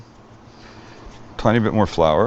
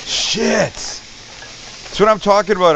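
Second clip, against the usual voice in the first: excited; 7 semitones higher, slower than usual. Real, not acted. Sizzling chicken hits grill.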